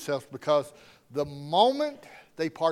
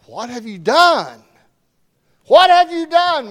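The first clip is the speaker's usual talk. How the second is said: loud, much higher demand, rising on the repeat; stern demand; 9 semitones higher, 13 dB louder than usual. Acted. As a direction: confront them with what they did. God confronts Adam and Eve.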